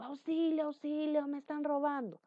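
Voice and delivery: mock yell to rouse the neighbourhood; demonstrated shout for help. Shown as a demonstration. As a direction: call out as if being robbed. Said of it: "Estoy gritando y me escucha todo mi barrio".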